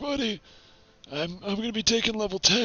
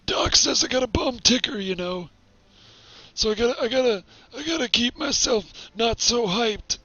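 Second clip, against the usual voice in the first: lightly voiced old man; rambling old-timer complaining. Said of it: "trying to keep the old man voice".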